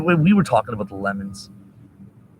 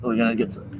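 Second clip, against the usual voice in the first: usual speaking voice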